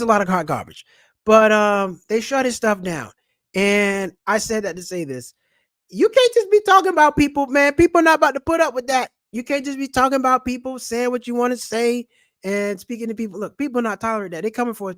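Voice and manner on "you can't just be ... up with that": loud, high rant; scolding from the gut, raised voice; ranting shout. Real, not acted. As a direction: call him out hard. Ranting at the slanderer.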